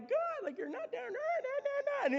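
high squeaky whining no no no; whiny protest. Retold or imitated. Moses protesting to God, whiny and high. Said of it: "Moses is kinda like".

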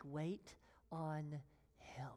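a slow, whispered "wait on him"; slow hushed whisper. Real, not acted. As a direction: draw it out in a whisper. Preacher whispers instruction.

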